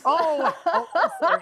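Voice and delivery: high embarrassed laugh. Real, not acted. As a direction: laugh at own mistake. Embarrassed at mishearing.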